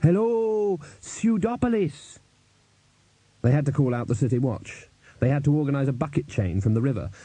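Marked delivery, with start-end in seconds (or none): 0.0-2.2 high, drawn-out stage greeting; calling to a crowd. Acted. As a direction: hail the whole audience like a rock frontman. Performer greets a concert crowd.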